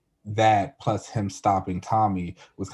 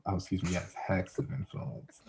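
sheepish correction; 9 dB quieter than usual. Real, not acted. Sheepish correction after being corrected.

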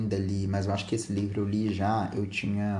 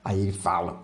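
normal talking voice

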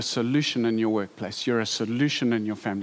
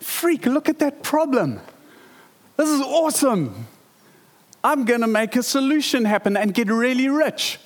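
much higher, thrilled voice of a guy spotting a problem; excited businessman; 10 semitones higher than usual. Retold or imitated. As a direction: hype it up like a money-maker who sees his chance. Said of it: "some guy goes".

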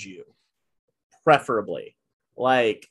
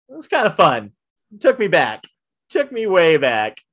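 usual speaking voice

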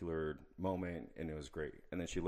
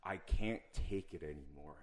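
ordinary talk

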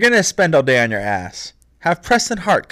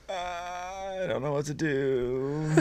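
much softer, slow, giving-up murmur; defeated mumble; 14 dB quieter, much slower than usual. Retold or imitated. Friend failing to reach Douglas, soft slow helplessness. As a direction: let it sink, helpless and slow.

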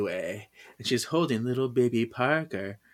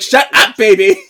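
high, loud mock snap at the baby; hammed-up shout to hush the baby; mock yell; 9 semitones higher, 14 dB louder than usual. Phoebe yelling at baby.

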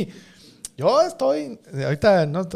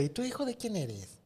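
soft, high nosy-relative question; lightly voiced elderly relative; imitating an old relative; 5 semitones higher, 11 dB quieter than usual. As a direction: ask like a great-aunt at a family party. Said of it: "un pariente que nos dice".

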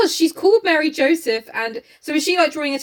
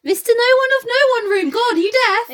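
high, raised-voice impatience; exasperated wife; 9 semitones higher, 3 dB louder than usual. Acted. Wife repeating it to deaf husband, high exasperated delivery. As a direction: repeat it sharply, pitched way up. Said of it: "she's like".

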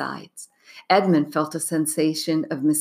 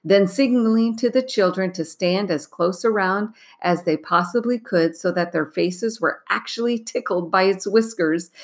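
usual speaking voice

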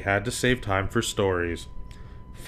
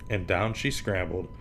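ordinary delivery

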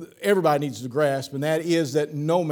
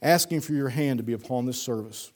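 softer, low prayer voice, bowed and sincere; quiet earnest prayer; 5 semitones lower, 4 dB quieter than usual. Acted. Pastor opening the service in prayer, soft earnest prayer. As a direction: pray over the service gently. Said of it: "Let's pray".

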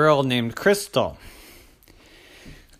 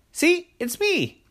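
high, slow dragon voice; proud dragon; 4 dB louder, slower than usual. Acted. The dragon finally shows itself to Crystal, high and slow. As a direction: present yourself with a flourish. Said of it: "the dragon said".

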